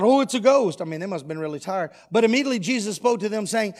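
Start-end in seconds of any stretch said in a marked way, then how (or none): 0.0-0.8 startled cry, louder and higher. Acted. Disciples spotting a ghost on the water, crying out. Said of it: "And they cried out".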